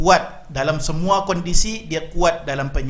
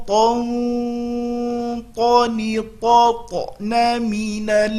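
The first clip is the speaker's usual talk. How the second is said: slow Quran recitation drill; 6 semitones higher, much slower than usual. Shown as a demonstration. Teacher leading students in a slow drill. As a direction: chant each syllable slowly and clearly.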